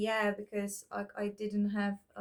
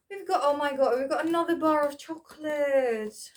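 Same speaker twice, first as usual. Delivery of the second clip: louder, delighted discovery of another chocolate bar; thrilled at finding a treat; real excitement over a chocolate find; excited; 4 semitones higher, 7 dB louder than usual. Real, not acted.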